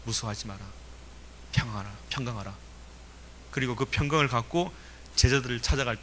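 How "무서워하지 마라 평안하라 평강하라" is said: low, softly spoken words of peace; quietly reassure, as if quoting Jesus; a touch of calm, lowered voice; lightly voiced Jesus. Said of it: "평강을 주시죠".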